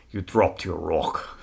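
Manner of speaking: slow, hushed quote of the Braveheart line "You dropped your rock."; a light nod to the film, no real accent; movie line quote. Retold or imitated. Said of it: "One of my favorite lines from that entire movie is".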